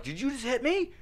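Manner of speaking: high, incredulous question; mock shocked parent. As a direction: act stunned at the toddler. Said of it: "I'm like".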